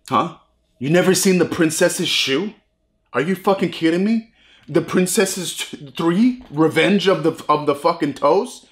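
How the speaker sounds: mock the snob who can't believe you missed it; incredulous film snob. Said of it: "You're like".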